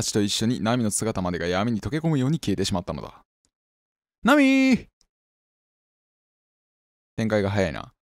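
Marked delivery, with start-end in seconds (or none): 4.2-4.9 much higher, loud drawn-out call; 声を張り上げて呼ぶ; long shout. Acted. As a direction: call out desperately across the house. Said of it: "僕は叫びながら".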